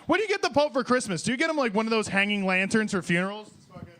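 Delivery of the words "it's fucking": normal talking voice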